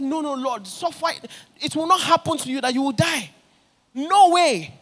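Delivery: high, rising, pleading protest; a preacher playing Peter's panicked denial. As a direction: beg him not to die, voice climbing to a loud no way.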